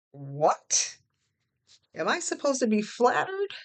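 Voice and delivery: incredulous question rising high; voice climbing in indignation. A narrator's reading. Woman answering her boyfriend's accusation, voice climbing high in indignation. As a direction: let the pitch climb in disbelief. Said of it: "My voice went up an octave".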